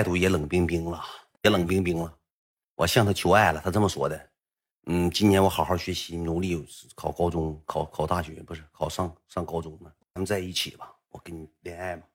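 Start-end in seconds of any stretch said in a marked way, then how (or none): 4.9-12.0 very soft, low murmur; shy confession. Retold or imitated. Boy and crush talking about dating, shy and hushed. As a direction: speak low and hushed, feeling out each phrase.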